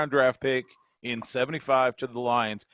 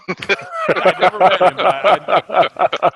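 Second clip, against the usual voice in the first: laughing; 6 semitones higher, 11 dB louder, much slower than usual. Real, not acted. Laughing at the nickname.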